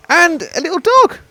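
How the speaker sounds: light up at the dog's arrival; delighted at dog. Real, not acted. Commentator cooing excitedly at the visiting Sheltie.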